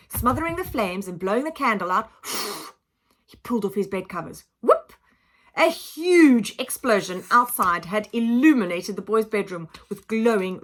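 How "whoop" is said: a sudden high, loud whoop. A narrator's reading. Narrator acting the explosion outside with a high loud whoop. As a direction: yelp it out. Narrator voices flaring light.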